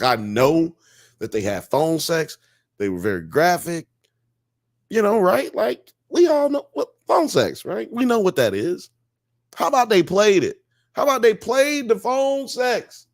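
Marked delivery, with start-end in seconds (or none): 4.9-13.1 high, disbelieving rhetorical questions; can you believe this, pitched up; incredulous. Real, not acted. Host asking the chat in disbelief, incredulous.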